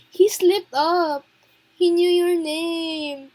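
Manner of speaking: slow, loud, stressed realisation; excited realisation. Real, not acted. Player telling Valeria the villain knew her name, slow and loud. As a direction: spell out the big reveal, thrilled.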